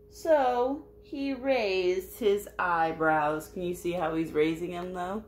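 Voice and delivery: plain reading voice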